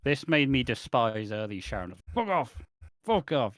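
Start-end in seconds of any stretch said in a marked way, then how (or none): 2.1-2.5 sharp high shout; mock shout. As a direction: shout it as a higher outburst. Quoting a shouted show line.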